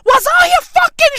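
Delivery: high, wailing fake sob, shouting in bursts; hammed-up tearful outburst; mock crying rant. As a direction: howl the complaint like a wronged child. Mock anguish over spinach.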